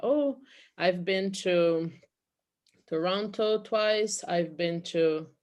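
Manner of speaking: slow, deliberate model sentences; modelled native phrasing. Shown as a demonstration. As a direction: say it slowly like a native example. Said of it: "é assim que eles vão falar". (fala lenta de exemplo)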